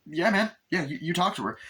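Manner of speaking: high, lightly voiced eager agreement; a keen sidekick agrees; mock eager voice; eager guy. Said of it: "I was like".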